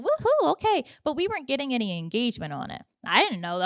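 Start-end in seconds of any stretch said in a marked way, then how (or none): 0.0-0.8 high, loud mock cheer; bright celebratory whoop; cheering woohoo. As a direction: squeal it like a happy launch. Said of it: "I was like".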